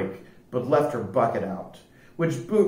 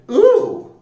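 very high, loud squeal of discovery; high-pitched thrilled "ooh"; excited shout; 7 dB louder than usual. A narrator's reading. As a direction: squeal out in delighted wonder. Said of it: "checked the hole and shouted".

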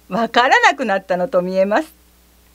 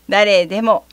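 ordinary delivery, nothing marked

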